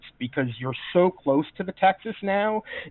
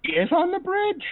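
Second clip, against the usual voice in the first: much higher, incredulous question; real surprise; 14 semitones higher than usual. Real, not acted. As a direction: blurt it out in high-pitched disbelief. GM learns Oakley is present.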